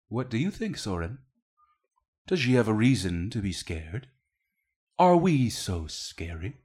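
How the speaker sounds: a hint of a smooth, controlling executive father; measured corporate father soothing his kids; lightly voiced father. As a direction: coax the children like a cold, doting patriarch.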